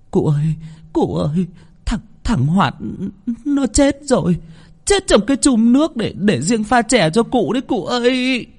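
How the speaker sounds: high, breathless panicked pleading. A narrator's reading. Servant woman breathlessly telling the master the boy is dead. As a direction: rush to tell the master in alarm. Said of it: "bà Xoan hớt hải".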